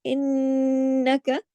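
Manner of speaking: इन्नका with the doubled nun held through the nose, slow; nasal ghunna. Shown as a demonstration. Teacher shows students the full ghunna, slow. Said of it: "अकमल यानी बड़ा वाला".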